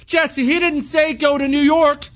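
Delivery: high, mocking voice of a doubting listener; mock listener objection. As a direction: squeak out the listener's excuse. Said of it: "and say".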